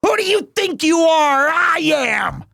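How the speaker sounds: high shouted catchphrase echo; mock bowler yell. Streamer yelling the bowler's catchphrase along with the clip. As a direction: yell the catchphrase back at the screen. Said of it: "echoing the bowling clip".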